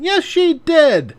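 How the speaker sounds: put-on slow high child talking back; stubborn child. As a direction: drag it out like a sulky kid. Child arguing in scene.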